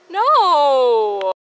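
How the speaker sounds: a high, loud, drawn-out no; loud wailing no; anguished no. Real, not acted. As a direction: refuse it with all your heart. Rejecting a sad headcanon.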